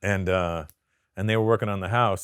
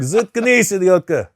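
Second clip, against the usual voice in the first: loud, high snap back in Russian; mock-furious yell in Russian; shouted Russian comeback; 11 semitones higher, 10 dB louder, slower than usual. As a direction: put down a heckler in Russian. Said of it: "learned a lot of Russian words".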